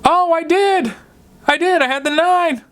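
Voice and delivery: very high-pitched put-on grandma voice; imitated grandma. Grandma answering furious grandpa after botching her turn, high mock-innocent voice. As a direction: play grandma discovering her slip, voice way up high. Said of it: "She'd be like".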